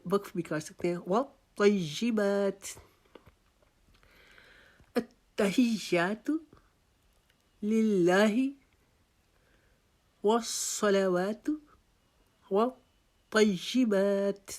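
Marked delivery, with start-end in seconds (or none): 1.0-2.8 وَالطَّيِّبَاتِ slowly, heavy ط and long با; slow tajweed recitation. Shown as a demonstration. Teacher reciting وَالطَّيِّبَاتِ slowly for students. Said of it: "با کو آپ نے ایک الف کے جتنا لمبا کرنا ہے". 4.9-14.5 slow, measured tajweed recitation; slow Quranic recitation. Shown as a demonstration. Teacher reciting the tashahhud slowly.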